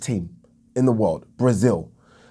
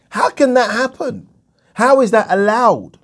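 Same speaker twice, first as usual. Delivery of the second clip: high, slow, incredulous questioning; indignant disbelief; 8 semitones higher, 3 dB louder than usual. Real, not acted. Boxing fan protests a promoter bending the rules, indignant. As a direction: demand an answer, stretching the words out in outrage. Outraged at rules being changed.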